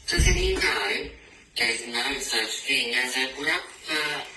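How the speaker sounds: high, slow, teasing; mocking mouse. Mouse laughing at the silly snake, high and slow. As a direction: draw it out mockingly.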